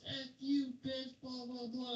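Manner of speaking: high-pitched mocking imitation of a screaming woman; hammed-up imitation of a drunk woman cursing; mock drunk yell. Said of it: "the chick goes out and goes".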